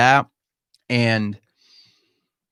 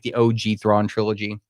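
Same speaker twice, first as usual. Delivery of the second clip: ordinary delivery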